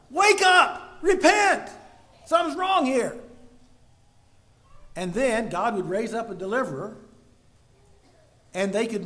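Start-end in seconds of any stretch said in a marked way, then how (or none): 0.1-3.2 loud, high alarmed cry to the sinful people; urgent wake-up call. Acted. God's warning to his disobedient people, a loud, high urgent cry. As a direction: shout the warning like an alarm bell. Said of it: "so that it would be like".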